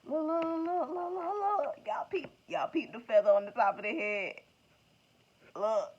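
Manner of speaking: loud, high excited look look look; bursting with glee, calling everyone to see; too happy. Real, not acted. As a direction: reader overexcited by the card, loud and high. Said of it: "I got a little bit too happy on that".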